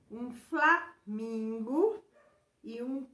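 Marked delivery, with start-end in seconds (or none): 0.3-2.0 slow "flamingo", a break after FLA; syllables sounded out. Shown as a demonstration. Teacher helping pupils hear the L sound, syllables sounded out. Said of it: "em voz alta para perceber os sons".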